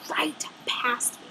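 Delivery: soft unvoiced letdown; deflated whisper. Real, not acted. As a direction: trail off into a whisper. Bus drives past her.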